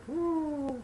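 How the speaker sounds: sudden high falsetto woo. Shown as a demonstration. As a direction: pop up high into head voice. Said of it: "instead of being like".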